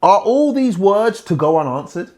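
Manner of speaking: high, loud indignant challenge; loud, high, exasperated question; indignant Zophar. Acted. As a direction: read it as an outraged friend. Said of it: "Then Zophar, the Namite, replied".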